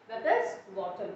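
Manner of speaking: ordinary talk, nothing marked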